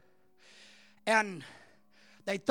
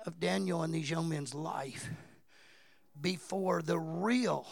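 hushed preaching; 5 semitones lower, 9 dB quieter than usual. Acted. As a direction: draw them in quietly.

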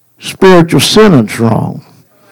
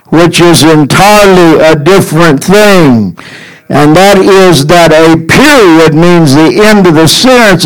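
ordinary talk, nothing marked

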